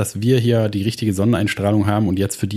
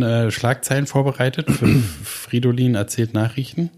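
normal talking voice

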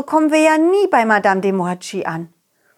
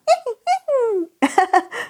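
high giggle; 13 semitones higher than usual. Acted. Character laughs.

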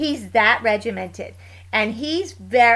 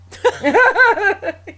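loud bright laugh, higher; laughing at people's surprise about her son; real high laugh; 11 semitones higher, 8 dB louder than usual. Real, not acted. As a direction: burst out laughing. Laughing at own punchline.

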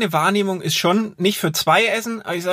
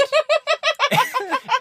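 bursting into high laughter. Real, not acted. Laugh after joke.

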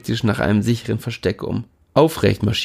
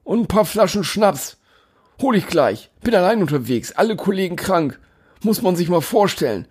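winded delivery man; 9 semitones higher than usual. A narrator's reading. Delivery man keeps talking.

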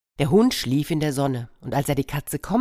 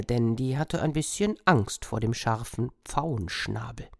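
ordinary delivery, nothing marked

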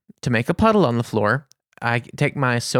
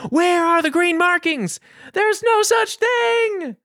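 very high, strained mock wail; hammed-up hysterical outburst, drawn out at the end; retold scream; slower than usual. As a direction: cry out like a lone protester against a conspiracy. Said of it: "then I screamed some more".

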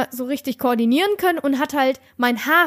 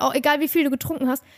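ordinary delivery

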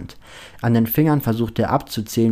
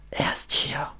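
seething inner voice; 4 semitones higher, 8 dB quieter than usual. A narrator's reading. Inner voice warning Lukas, seething whisper. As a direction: whisper it like a voice simmering inside his head. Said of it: "brodelt die Stimme in ihm".

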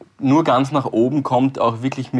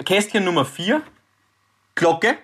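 slow suspense reveal; 6 semitones higher, slower than usual. Acted.

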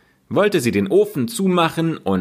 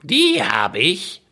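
slow, higher witch voice savouring the catch; a greedy witch gloating, drawn out; 7 semitones higher, slower than usual. Acted. Witch gloating over the caught children, slow and high.

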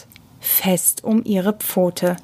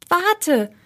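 high-pitched urgent call; urgent plea, pitch jumping up; 9 semitones higher than usual. A narrator's reading. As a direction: call after someone leaving. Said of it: "rief Hopsi".

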